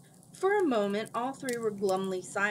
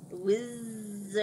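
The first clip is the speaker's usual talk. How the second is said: soft, distant echo of the word. A narrator's reading. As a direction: breathe it like a faraway echo. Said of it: "the soft voice echoes".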